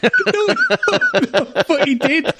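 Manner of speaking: breaking into high laughter mid-protest; laughing. Real, not acted. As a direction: laugh through the denial, pitch shooting up. Laughing while insisting it's true.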